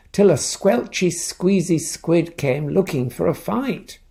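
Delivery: lively storybook voice with character voices; reading to children. A narrator's reading. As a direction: read it like bedtime story time for kids. Reads picture book aloud.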